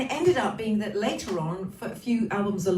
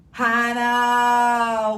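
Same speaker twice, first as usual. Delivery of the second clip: a big happy shout of a name; calling out; 3 semitones higher, 7 dB louder than usual. Real, not acted. Greeting Hannah on the stream with a loud call.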